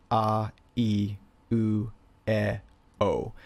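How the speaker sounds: A, E, U, E, O as pure ah, ee, oo, eh, oh; Japanese vowel sounds. Shown as a demonstration. Teacher showing how the letters are pronounced, Japanese vowel sounds. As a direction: say A, E, U, E, O slowly, one pure Japanese vowel at a time. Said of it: "but this is pronounced".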